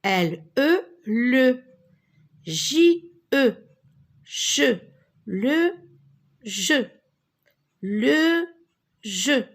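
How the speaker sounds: L, E as "le", J, E as "Je", then "Le jeu"; emphatic teacher's blending of "Le jeu", pauses between words; syllable breakdown. Shown as a demonstration. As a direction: sound out each syllable, then say "Le jeu" word by word.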